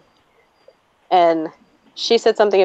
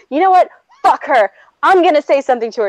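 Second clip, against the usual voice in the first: high, fired-up defiance; heated, indignant outburst; retold screaming; 6 semitones higher than usual. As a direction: declare it in a huff.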